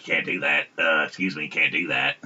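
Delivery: soft whiny nasal nerd voice; prissy rule stickler, nasal and quiet; nasally voice. Retold or imitated. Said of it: "And be like".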